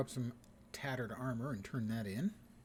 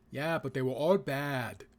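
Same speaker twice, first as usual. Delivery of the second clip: loud, slow mock Austrian accent; Arnold Schwarzenegger; 9 dB louder than usual. Action hero excuses his killings to wife, mock Austrian accent. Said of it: "is my Arnold Schwarzenegger impersonation".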